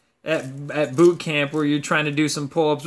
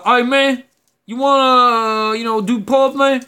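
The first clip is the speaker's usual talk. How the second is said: Southern dude impression; 7 semitones higher, 6 dB louder than usual. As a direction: call it out like a Southern army guy. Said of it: "he's like".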